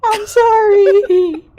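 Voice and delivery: slow, sobbing sorry; crying apology. Acted. Seren sobs her apology to Alara. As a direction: drag it out through tears. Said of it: "She begins to cry".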